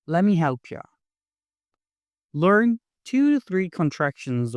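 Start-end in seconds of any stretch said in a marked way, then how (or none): 0.1-0.8 "let me help ya" blended as "lemme help ya"; quick linked "lemme help ya"; reduced casual form. Shown as a demonstration. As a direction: run "let me" together, ending on "ya".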